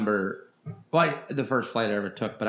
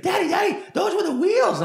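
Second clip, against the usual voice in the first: put-on much higher, louder little-kid voice; excited kid; 6 dB louder than usual. Kid on a plane tells his dad about the turn, excited and very high. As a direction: squeal it like a thrilled child.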